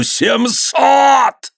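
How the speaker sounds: high, drawn-out bellow; enraged roar. A narrator's reading. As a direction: roar the sum in fury. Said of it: "заревел он". (яростный рёв)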